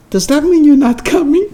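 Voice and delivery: high, plaintive question; hopeful daughter. Acted. Daughter pleading with the wrong number, high and plaintive. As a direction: ask it with fading hope.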